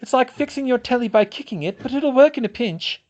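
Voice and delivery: high, loud, defensive retort; snappish. A narrator's reading. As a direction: justify yourself testily. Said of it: "Hermione snapped".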